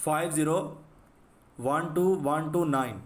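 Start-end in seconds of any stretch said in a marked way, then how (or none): none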